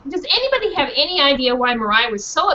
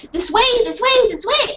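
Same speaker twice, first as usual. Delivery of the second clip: high urgent barks; little dog yapping the way; dog leading; 10 semitones higher than usual. Acted. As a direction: call them along like an eager dog. Dog leads teachers.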